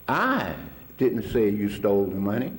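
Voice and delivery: "I" set apart and leaned on, then a pause; stress on I. Retold or imitated. Student quoting his teacher's lesson on emphasis, stress on "I". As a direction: lean hard on "I", pause before "didn't". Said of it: "how the emphasis on the thing changes its meaning".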